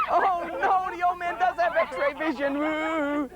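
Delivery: high, slow little-girl voice in mock alarm; a child realizing she's caught, voiced high; scared little girl. Child's alarmed thought retold.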